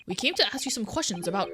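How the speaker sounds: usual speaking voice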